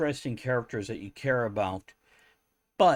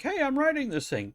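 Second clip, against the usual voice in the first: high excited quote. Retold or imitated. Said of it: "I'm like".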